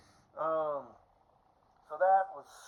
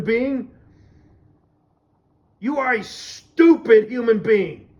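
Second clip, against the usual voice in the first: loud, raised, hammering tirade; exasperated rant; 3 semitones higher, 7 dB louder than usual. Real, not acted. Fed up with throwing the ball back, exasperated rant. Ranting about pointless small talk.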